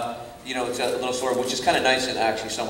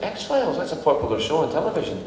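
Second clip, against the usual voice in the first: high, impressed Scottish father; impressed Scottish dad; 7 semitones higher than usual. Retold or imitated. Dad hearing son landed X-Files part, impressed Scottish voice. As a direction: marvel at the show name.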